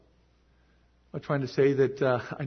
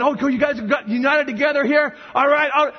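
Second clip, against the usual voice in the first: raised, louder mock-approving voice; acted God speaking; 7 semitones higher, 4 dB louder than usual. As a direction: play at being impressed by the group. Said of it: "He looks at America and he's going".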